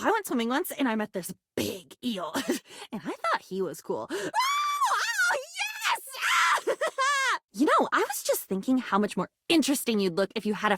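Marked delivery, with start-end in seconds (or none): 4.1-4.8 sharp high delighted gasp; a big excited gasp. Acted. 4.9-5.4 high gleeful shouts; high excited oh oh. Acted. Overjoyed at a new friend. 5.4-6.6 high shrieking laugh of joy; gleeful shouting laugh. Acted. Overjoyed laughing after yes. 6.7-6.8 high gleeful shouts; high excited oh oh. Acted. Overjoyed at a new friend.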